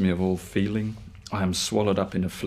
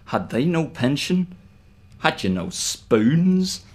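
Norfolk accent; 4 semitones higher, 4 dB louder than usual. Shown as a demonstration. Host reads the list aloud to the radio audience in a light Norfolk accent. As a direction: read it like a Norfolk countryman, lightly put on. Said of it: "should be read in a Norfolk, a strong Norfolk accent, so I will".

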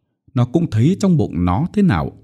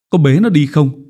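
louder, higher scolding question; mock scolding; 6 semitones higher, 6 dB louder than usual. A narrator's reading. Mother scolding the man who scared her boy, raised voice. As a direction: chide him in a raised voice.

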